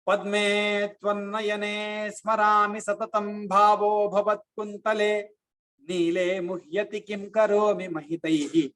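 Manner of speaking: slow, measured Sanskrit chant; slow Sanskrit verse recital; shloka recitation. Acted. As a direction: recite the verse with devotion, slowly.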